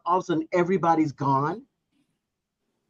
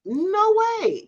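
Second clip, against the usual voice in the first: very high-pitched, drawn-out disbelief; emphatic high protest; incredulous refusal; 14 semitones higher than usual. Real, not acted.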